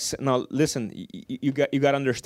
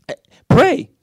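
sudden high call; 12 semitones higher, 7 dB louder than usual. Real, not acted. Exclaims advice.

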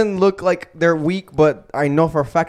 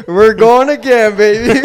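laughing, higher and louder mock glee; mock eager taunt; 4 semitones higher, 6 dB louder, slower than usual. Friend mocks the eager fighter, laughing and loud. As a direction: tease him like a fighter itching for more.

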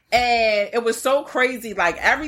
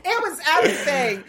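high, laughing delight; gushing with laughter; 9 semitones higher than usual. Retold or imitated. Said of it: "I was like".

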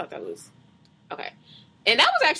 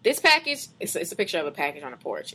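usual speaking voice

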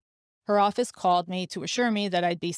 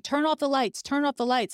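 high, urgent mock shout of an order, falling at the end; retold doctor shouting; 6 semitones higher than usual. Doctor barking at the staff, a mock shout that falls at the end. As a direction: snap the order out like a doctor mid-procedure. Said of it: "the doctor started shouting".

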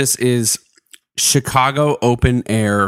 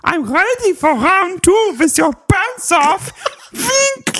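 put-on high sing-song Dutch boy voice. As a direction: read it as a silly Dutch boy. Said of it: "like, Dutch boy voice".

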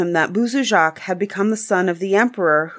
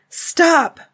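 cry out; 10 semitones higher, 3 dB louder than usual. Acted. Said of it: "cried the wood witch".

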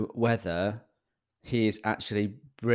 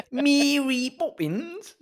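high, drawn-out mock bad Cockney accent; 5 dB louder, much slower than usual. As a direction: send up a terrible film Cockney accent, loud and slow.

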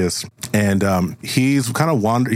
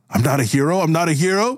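acted identity crisis, rising higher; escalating breakdown; 8 semitones higher, faster than usual. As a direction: pitch climbing with each repeat. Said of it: "having a little bit of a breakdown".

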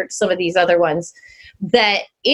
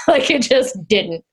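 ordinary talk

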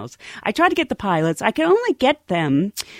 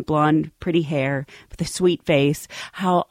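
normal talking voice

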